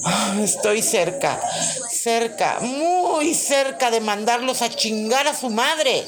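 loud, high, fed-up shouting; exasperated caller. Acted. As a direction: yell at a useless operator. Skit, furious caller.

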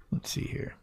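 whispered aside to himself; whispering. Real, not acted. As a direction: whisper it while searching. Searching the app, muttering aside.

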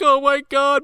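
high, frantic mock panic; panicked Cameron. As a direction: squeal it out like a panicking teen. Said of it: "Cameron's like".

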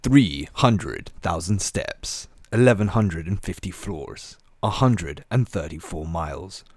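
slow, booming cinematic trailer narration; movie trailer voice. Acted. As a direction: announce each number like an epic film trailer. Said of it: "with cinema T V trailer guy voice".

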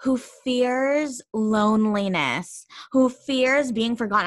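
each phrase stretched for dramatic depth. Retold or imitated. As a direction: recite a student's poem with heavy, drawn-out weight. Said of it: "some deep stuff in their poem".

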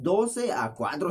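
ordinary delivery

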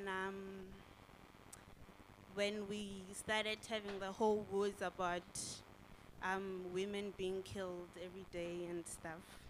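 ordinary delivery

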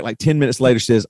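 ordinary talk, nothing marked

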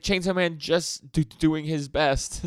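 high, loud, slow feeble plea; a boy begging for his hero's sake; Denji, pleading. Retold or imitated. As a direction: stretch it out in a high whine. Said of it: "He's like".

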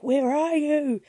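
high, slow, loud call from afar; distant call. Retold or imitated. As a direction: call out searching for him. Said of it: "the voice repeats".

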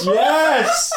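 high laughing yes of delight; excited cheer. Real, not acted. Cheering a pitched tagline.

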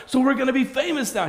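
high, loud, fast "famous now"; eager interruption. Acted. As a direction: jump in, thrilled at fame.

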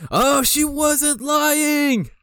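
high, slow astonished cry; squeaky disbelief; surprised gasp. Retold or imitated. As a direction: stretch it out in shock. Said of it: "and I'm like".

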